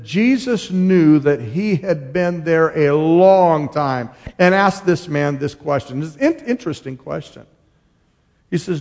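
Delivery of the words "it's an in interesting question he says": ordinary delivery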